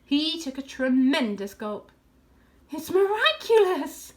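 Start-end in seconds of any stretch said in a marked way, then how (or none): 2.7-4.1 high, loud, awed exclamation; breathless awe. A narrator's reading. Fox after a tremendous gulp, breathless awe. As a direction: struggle for breath in wonder. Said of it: "he whispered, fighting for breath".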